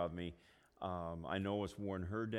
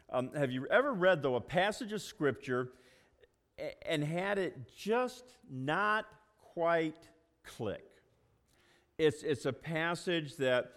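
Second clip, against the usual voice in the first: raised, louder pulpit voice building the message; preaching; 7 semitones higher, 5 dB louder than usual. Acted. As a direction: draw the congregation into the passage. Launches the sermon message.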